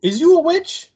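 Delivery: high put-on voice, gawking; high mock-astonished witch question; mock amazed Tara. Voicing Tara's amazed question.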